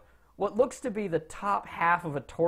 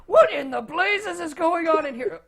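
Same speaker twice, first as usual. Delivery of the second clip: put-on high, loud outburst from a startled old-style wizard; high-pitched indignant outrage; irate wizard; 10 semitones higher, 5 dB louder than usual. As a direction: burst in demanding an explanation, pitched high and loud. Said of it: "a man ... opens up and says".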